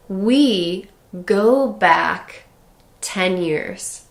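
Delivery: slowly stretched We go back; slow, drawn-out example. Shown as a demonstration. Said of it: "So you could say".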